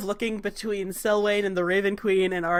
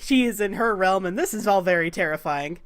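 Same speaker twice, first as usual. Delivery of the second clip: usual speaking voice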